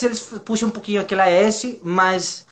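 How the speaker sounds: normal talking voice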